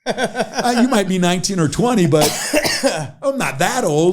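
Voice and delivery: high, laughing mock indignation; amused, wounded pride; laughing protest. Real, not acted.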